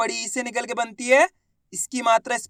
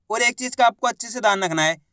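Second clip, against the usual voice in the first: usual speaking voice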